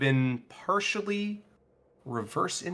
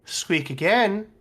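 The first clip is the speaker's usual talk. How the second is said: higher, slow taunting challenge; menacing dare; 6 semitones higher, 4 dB louder, slower than usual. Acted. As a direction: dare him to try it. Giant threatens the squirrel.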